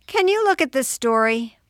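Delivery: put-on high, loud kid voice; acted ten-year-old asking Mom; young boy. A narrator's reading. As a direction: ask for help like an eager kid.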